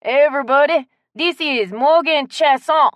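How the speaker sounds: hearty Southern shout of introduction; announcing to the room. Acted. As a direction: call out to the whole store. Shopkeeper announces newcomer to the room.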